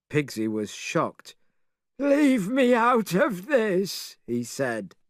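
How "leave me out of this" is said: high, slow pig voice; pig character voice. Acted. Pigsy refuses, high character voice.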